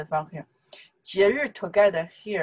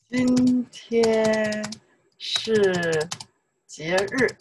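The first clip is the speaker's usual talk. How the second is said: long, held syllables with short breaks; drawn-out demonstration; much slower than usual. Shown as a demonstration. Teacher modelling a sentence for the class, drawn out. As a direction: stretch each word for learners to copy.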